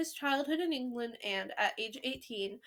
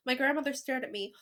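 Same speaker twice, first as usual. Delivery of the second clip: usual speaking voice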